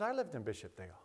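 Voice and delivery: low, quiet echo; subdued retold echo. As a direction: drop it low and soft. Don's echo of the same words.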